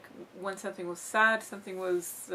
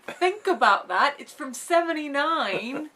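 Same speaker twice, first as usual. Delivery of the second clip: emphatic; 6 semitones higher, 7 dB louder, slower than usual. Retold or imitated. Arguing with people who dismiss Alien.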